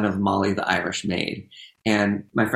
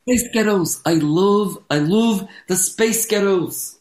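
Scottish accent trick; 8 semitones higher, 3 dB louder, slower than usual. Shown as a demonstration. Performer trying the Spice Girls trick, high hammed-up Scottish accent. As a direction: gush in an exaggerated Scottish accent. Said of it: "it sounds like Spice Girls".

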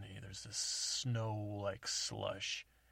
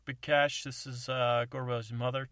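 over CB radio; 3 semitones higher, 9 dB louder than usual. Acted. CB radio call.

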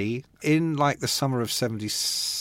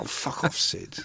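muttered brush-off; 7 dB quieter than usual. Retold or imitated. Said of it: "I was like".

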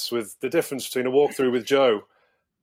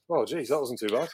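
relieved mutter; 6 dB quieter than usual. Retold or imitated. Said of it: "you walked out going".

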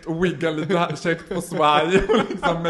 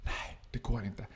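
low, very soft refusal; dämpat nej; 4 semitones lower, 12 dB quieter than usual. Acted. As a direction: refuse quietly, well under normal volume. Acts out the man's quiet refusal. (tyst, lågt avvisande)

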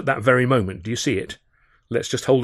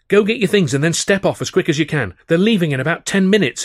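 urgent raised call, higher and louder; cried out; 7 semitones higher, 6 dB louder than usual. A narrator's reading. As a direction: hurry them off the ship with urgency. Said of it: "he cried".

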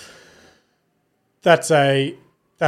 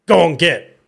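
louder, higher mock-dramatic command; movie-scene shooing; 5 semitones higher, 6 dB louder than usual. Owner acting out a movie scene to shoo the dog away, mock-dramatic shout. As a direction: re-enact a film line shooing an animal off. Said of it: "the movie scene where I was like".

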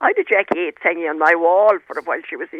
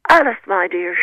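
a touch of a grand Anglo-Irish lady; imitating an elderly Anglo-Irish aristocrat, lightly; mild posh Anglo-Irish voice; Anglo-Irish accent; 4 dB louder than usual. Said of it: "in her best Anglo-Irish accent".

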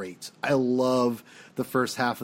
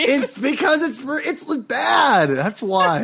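high, loud, half-laughing insistence; exasperated protest; 8 semitones higher, 5 dB louder, slower than usual. Real, not acted. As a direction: pitch it way up and protest loudly. Defends complaint after being teased.

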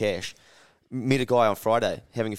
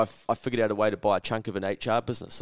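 ordinary talk, nothing marked

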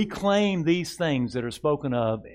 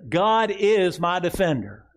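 slow, higher and louder declaration; slow, raised proclamation; 6 semitones higher, 5 dB louder, slower than usual. Shown as a demonstration. Pastor leading the congregation in a slow proclamation. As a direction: ring it out slowly to the church. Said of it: "Say this with me".

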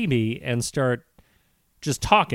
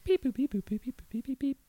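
high, soft imitated beeps and boops; computer beeps; 9 semitones higher, 11 dB quieter than usual. Co-host pretending to look up CB jargon, high computer beeping. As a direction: play a searching computer in tiny high electronic blips.

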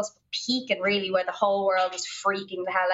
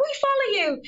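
put-on high, chirpy Irish girl voice; Kildare Irish accent. Kildare girls telling the couple they follow them, high Irish accent. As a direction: toss it off brightly in a Kildare accent. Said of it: "hearing the accent".

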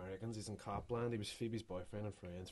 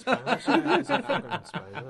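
much higher and louder, slow laughing repeat; 14 dB louder, slower than usual. Real, not acted. Laughing hard at a Spaniard playing a Highlander. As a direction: squeal the word out laughing.

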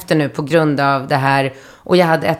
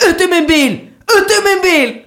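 high-pitched imitation of a furious yell; mock screaming; 13 semitones higher, 3 dB louder than usual. Driver throwing passenger out of car, mock screaming. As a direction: yell the order like an enraged driver, hammed up. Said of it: "alltså skrik".

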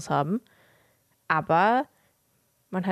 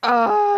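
loud high gasp before a sneeze; sharp sneeze build-up gasp, loud and high; about to sneeze; 13 semitones higher, 8 dB louder than usual. Real, not acted. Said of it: "Ich muss niesen".

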